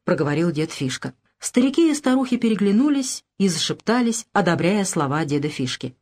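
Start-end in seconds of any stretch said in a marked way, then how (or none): none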